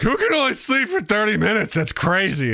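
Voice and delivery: high, mocking scoff of his old self; mocking disbelief. Mocking his old view of short naps, in disbelief. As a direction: sneer at the idea of short naps. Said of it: "I used to understand, like".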